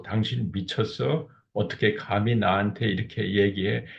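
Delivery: ordinary talk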